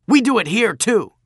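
loud, high, stung retort; defensive outburst. Acted. Bully stung by the backwater jibe, loud defensive retort. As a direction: protest hotly at the slight.

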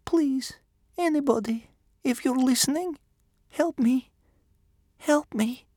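put-on high, whispery little boy begging; silent repeated plea. A narrator's reading. Boy prays inwardly to father and gods, whispered begging. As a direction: plead over and over in a high, breathy whisper. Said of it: "inside his head, he repeated over and over".

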